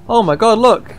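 high, loud burst of amazement; excited discovery. Real, not acted. Player spots the first steamship propeller, excited.

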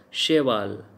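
"cheval" starting with sh; sh sound. Shown as a demonstration. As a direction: soften the ch of "cheval" to sh. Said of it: "C H is pronounced as sh".

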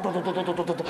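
low, rapid rhythmic to-to-to; helicopter chop. Acted. Comedienne imitates the helicopter over the stage, steady low chopping. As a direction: chop out a helicopter rotor on one low note.

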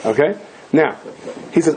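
usual speaking voice

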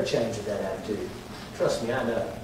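low, hushed reproof after the shouting; quiet aside. Real, not acted. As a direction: drop low and confide it. Sudden drop after mocking excuses.

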